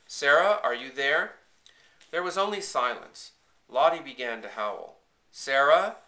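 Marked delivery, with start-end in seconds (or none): 0.1-1.4 loud, high call up the stairs; raised, searching question. A narrator's reading. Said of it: "Papa called up the stairs". 5.3-6.0 loud, high questioning cry; anxious shout for someone missing; frightened call. A narrator's reading. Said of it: "His voice sounded frightened".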